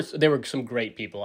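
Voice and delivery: normal talking voice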